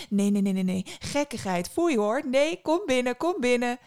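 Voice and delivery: put-on loud, rapid-fire scolding old lady; high, bustling old woman; fussing old woman. A narrator's reading. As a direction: tut and hurry the guest inside. Old woman shoos and invites in.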